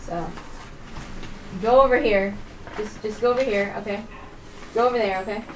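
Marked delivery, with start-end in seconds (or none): none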